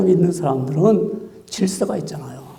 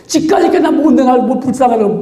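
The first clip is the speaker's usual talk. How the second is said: mock outburst, pitched up high; angry retort; 4 semitones higher than usual. Someone retorting to being pitied, acted angry outburst. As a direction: act out taking offense at pity. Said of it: "막 화를 내고".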